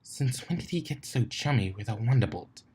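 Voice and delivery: a soft aside, voiced as another pony; hushed gossip. A narrator's reading. Rarity leaning to whisper into Twilight's ear, hushed gossip. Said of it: "Rarity leaned to whisper".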